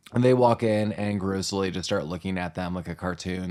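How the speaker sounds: usual speaking voice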